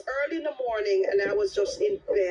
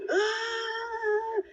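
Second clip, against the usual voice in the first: imitated scream; 11 semitones higher than usual. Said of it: "Like, loud".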